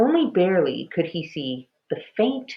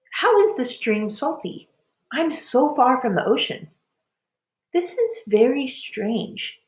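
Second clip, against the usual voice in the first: wondering; 7 semitones higher than usual. A narrator's reading. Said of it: "Minley began to wonder".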